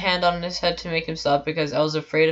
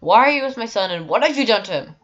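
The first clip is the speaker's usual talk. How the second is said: higher, louder angry mother; angry yell; 6 semitones higher, 7 dB louder than usual. Retold or imitated. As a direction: yell it at a stranger. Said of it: "she yelled at me".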